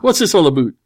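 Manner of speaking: high, loud stock Canadian accent. Shown as a demonstration. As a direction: put on a caricature Canadian accent. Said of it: "Yeah, Canadian".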